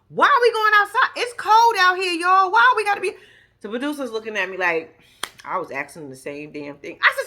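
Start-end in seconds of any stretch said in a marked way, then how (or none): 0.1-3.1 high, loud retold protest; loud complaining. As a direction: protest being dragged out into the cold. Protesting a wedding outside in cold.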